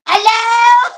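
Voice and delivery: very high, loud caricature hello; hammed-up phone greeting; mock accented hello. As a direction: squeal it like a call-center greeting. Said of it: "They know how to say it".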